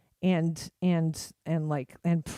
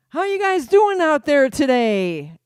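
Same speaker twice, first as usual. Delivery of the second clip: loud, slow, higher hammed-up crowd call; mock stage host; 8 semitones higher, 9 dB louder than usual. Comedian on stage calling out to the audience, hammed up. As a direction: work the crowd like a cheesy MC. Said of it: "it's not like".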